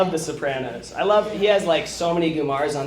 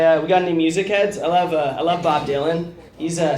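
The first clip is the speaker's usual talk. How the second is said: ordinary talk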